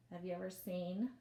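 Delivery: ordinary delivery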